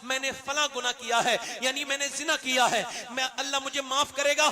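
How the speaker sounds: loud fervent sermon appeal; preaching at full force. Acted. Preacher urging young men to repent, loud and fervent. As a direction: call the youth to repent.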